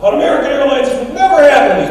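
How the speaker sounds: high, loud mock rant; imitating angry yell. Tourist chewing out the airline clerk, high loud rant. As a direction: shriek it like a furious customer. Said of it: "he's screaming".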